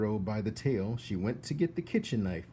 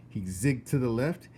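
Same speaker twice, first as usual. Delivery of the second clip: plain reading voice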